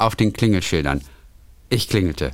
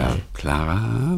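whisper; 4 semitones lower, 4 dB quieter, much slower than usual. Pretend. Mock reunion scene.

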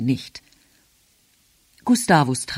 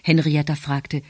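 ordinary delivery

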